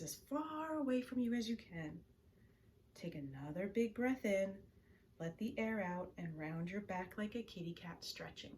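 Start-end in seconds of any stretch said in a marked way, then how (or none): none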